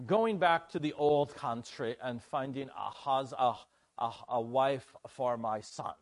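amateur attempt at a Dutch accent; half-practised Dutch accent from the old country; bad Dutch accent. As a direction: put on a clumsy Dutch accent. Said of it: "That's my Dutch accent. It's not very good".

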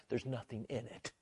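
normal talking voice

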